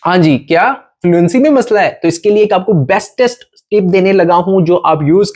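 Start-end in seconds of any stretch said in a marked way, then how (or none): none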